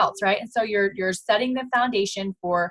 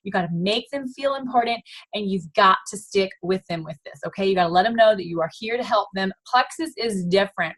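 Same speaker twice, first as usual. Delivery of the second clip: ordinary talk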